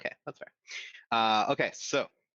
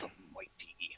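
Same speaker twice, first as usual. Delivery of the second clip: whisper; 4 semitones lower, 16 dB quieter, slower than usual. Pretend. GM echoes the player's intro.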